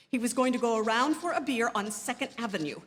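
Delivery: normal talking voice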